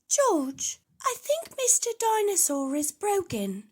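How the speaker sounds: a hint of a bossy big sister telling her brother; Peppa's voice. A narrator's reading. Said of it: "Peppa says".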